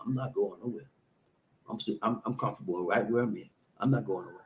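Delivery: soft, low stubborn grumble; mock stubborn refusal. Boyfriend refusing to leave the house, soft and low. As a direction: dig in and refuse quietly. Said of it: "and you like".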